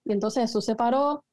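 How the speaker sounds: ordinary talk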